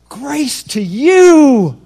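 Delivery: very slow, high, loud grace to you; angel's greeting. Acted. Angel greeting Mary, drawn-out loud proclamation. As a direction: stretch every word like a heavenly herald. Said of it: "That's what it looks like".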